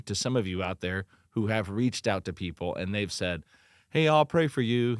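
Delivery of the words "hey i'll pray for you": imitating glib comfort, higher and louder; shallow sympathy. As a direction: mimic an empty, too-ready promise. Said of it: "they've been shallow".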